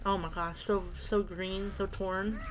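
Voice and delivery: ordinary delivery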